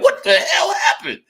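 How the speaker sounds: student gawking at a marked-up paper; mock shock. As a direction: squeal it like the paper bled. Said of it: "You be like".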